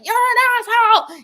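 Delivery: high, loud mock road-rage yell; hammed-up yell at other drivers; stuck in traffic, yelling at other drivers in mock rage; mock angry yell. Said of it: "yell at people and be like".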